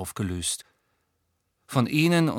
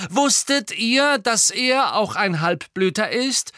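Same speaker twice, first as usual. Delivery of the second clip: raised, loud, slow provocation; reckless daring; 10 semitones higher, 6 dB louder, slower than usual. A narrator's reading. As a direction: throw the revelation boldly at them. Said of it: "sagte Harry verwegen".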